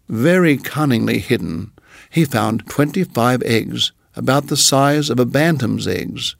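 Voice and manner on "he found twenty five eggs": plain reading voice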